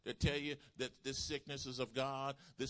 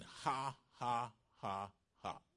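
slow, separate ha-ha-ha; deliberate spoken laugh; slower than usual. Retold or imitated. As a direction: say each "ha" as its own word, with pauses. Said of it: "started saying, 'Ha".

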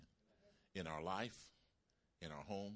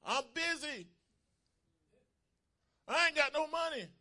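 high, loud protesting yell; shouted excuse; 9 dB louder than usual. Acted. People shout excuses out to God, shouting. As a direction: holler it up at heaven. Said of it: "what do people shout out to God".

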